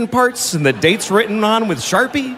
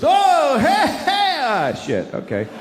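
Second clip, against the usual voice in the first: bubble watcher; 9 semitones higher, much slower than usual. Retold or imitated. Watching a giant soap bubble pop, excited then let down. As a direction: hype it up, then deflate to a shrug.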